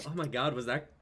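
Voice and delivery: ordinary delivery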